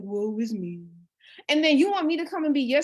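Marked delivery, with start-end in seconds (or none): 0.0-0.8 low, soft, mopey donkey moan; gloomy Eeyore. Retold or imitated. Eeyore moping to his friends, low and soft. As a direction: droop every word like Eeyore sighing at life. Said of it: "you're like".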